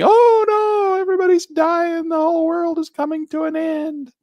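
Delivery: high, loud mock panic wail; panicked texters. Mocking panicked subscribers who text him at dawn, loud high wail. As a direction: ham up the frightened subscribers. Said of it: "I get in text messages".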